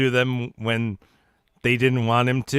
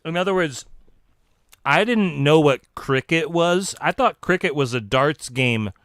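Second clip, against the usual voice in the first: usual speaking voice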